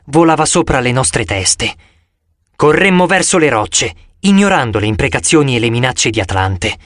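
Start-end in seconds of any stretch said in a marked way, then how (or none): none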